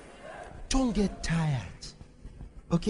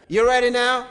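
full-force preaching; 10 semitones higher than usual. Real, not acted. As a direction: rouse the congregation to battle in prayer. Rallies congregation into intercession.

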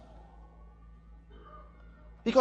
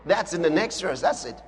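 normal talking voice

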